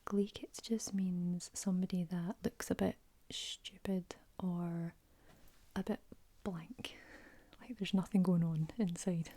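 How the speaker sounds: Scottish words ASMR whisper. Real, not acted. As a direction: whisper it gently like a bedtime secret.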